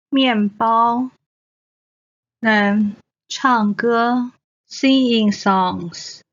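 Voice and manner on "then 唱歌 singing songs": normal talking voice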